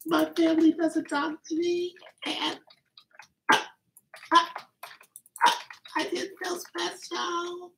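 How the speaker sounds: high, soft, teary-sounding put-on voice; hammed-up teary gratitude, high and soft; mock emotional. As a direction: fake a choked-up thank you, high and soft. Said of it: "I'm just a little emotional right now".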